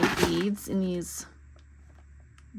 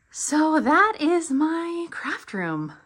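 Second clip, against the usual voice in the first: breathless, slow wrap-up; winded, catching your breath; 8 semitones higher, much slower than usual. Real, not acted. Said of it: "I don't know why I'm out of breath".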